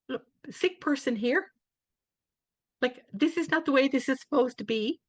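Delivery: a sick patient mock-complaining to herself; mock indignant protest. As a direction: play up the outrage at being asked, half joking.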